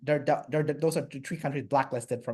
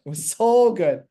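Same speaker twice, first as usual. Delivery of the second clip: higher and louder, gushing with enthusiasm; excited raving; 6 semitones higher, 6 dB louder than usual. Real, not acted. Traveller raving about the food in Iran, loud excited delight. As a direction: rave about it, leaning hard on "so".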